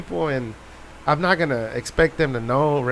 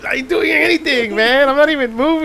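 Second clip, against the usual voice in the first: mock protest; 9 semitones higher, 5 dB louder than usual. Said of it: "I was like".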